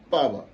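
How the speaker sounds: papa loud and falling, last syllable light; neutral second syllable. Shown as a demonstration. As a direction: hit the first "pa" of "papa" high, let the second go toneless. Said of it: "il n'y a pas de ton".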